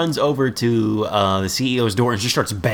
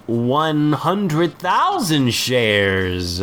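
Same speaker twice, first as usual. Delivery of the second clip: slow, drawn-out mock boast, pitched up; grand bluff; 5 semitones higher, much slower than usual. Sitcom schemer bluffs a stockholding, grandly drawn out. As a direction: stretch the number out grandly. Larry bluffs about share count.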